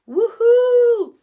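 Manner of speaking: loud high woohoo; loud high celebratory whoop; cheer. Real, not acted. Cheering no ketchup.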